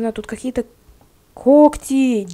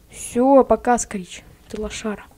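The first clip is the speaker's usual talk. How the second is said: usual speaking voice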